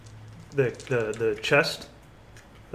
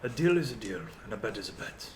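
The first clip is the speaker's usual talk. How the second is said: soft, understated villain voice; villain's parting words; 5 semitones lower, 12 dB quieter, much faster than usual. Acted. Villain leaving in his carriage after losing a bet, quiet menace. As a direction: quietly concede with lingering menace. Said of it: "and he says".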